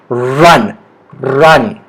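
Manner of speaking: "run" slow and low with a clear R; careful R sound. Shown as a demonstration. Teacher drills learners on R, careful low "run". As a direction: lean into the opening R of "run". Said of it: "you can say it with me".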